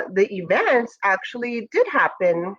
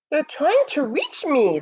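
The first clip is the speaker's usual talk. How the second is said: marveling moon; 6 semitones higher than usual. Acted. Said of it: "the moon marveled".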